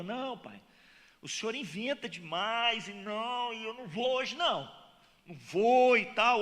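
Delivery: a grumbling son who won't go; refusing son. Acted. Lazy second son refusing father. (filho resmungando que não vai, voz mais alta e arrastada)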